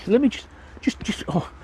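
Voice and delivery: out of breath. Real, not acted. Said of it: "I'm out of breath now".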